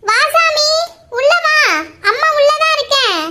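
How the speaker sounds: high, fond welcome for a son; doting mother. Acted. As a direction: call your boy in, delighted. Mother welcomes her son home.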